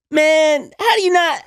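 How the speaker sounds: high, drawn-out whine; imitated disbelief. Woman stunned he doesn't know, high mock disbelief. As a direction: exclaim in her high put-on voice.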